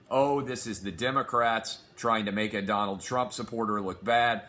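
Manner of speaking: usual speaking voice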